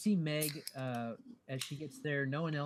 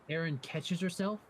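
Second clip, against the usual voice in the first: usual speaking voice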